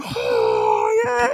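high mock "oh" like a cheering fan; hint of a hand-cupped crowd cheer; a quick falsetto fan cry. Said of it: "cupping your mouth, going".

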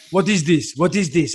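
lightly voiced Russian accent, scolding; a Russian coach's mild rebuke; Russian accent, mock scolding. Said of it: "heavy Russian accent".

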